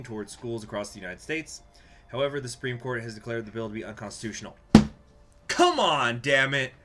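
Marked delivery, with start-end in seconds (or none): 5.5-6.7 real exasperated outburst; loud, higher burst of annoyance; frustrated. Real, not acted. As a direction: protest loudly in frustration. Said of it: "things like this just frustrate".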